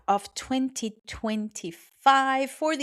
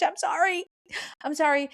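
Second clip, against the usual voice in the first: high apology; 8 semitones higher than usual. Real, not acted. Apologizing to guests.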